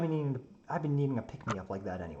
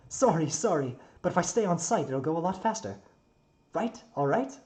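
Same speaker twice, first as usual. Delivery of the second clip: higher, eager bargaining voice; pleading young builder; 7 semitones higher, 7 dB louder than usual. Acted. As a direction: talk fast-footed excuses, hopeful at the end. Plays Tsukihiko pleading.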